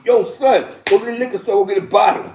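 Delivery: put-on loud, high bossy call to the young one; loud barking errand order; mock order. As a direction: boss him off to the store. Imitating older dude sending him.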